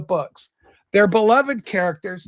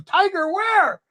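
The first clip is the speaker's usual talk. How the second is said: startled tiger; 10 semitones higher, slower than usual. Retold or imitated. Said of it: "Hobbes, the tiger, goes".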